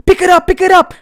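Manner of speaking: mock dad's urgent yell, high and loud; imitated dad shouting. Dad ordering his child over the knocked hub pipe, shouting.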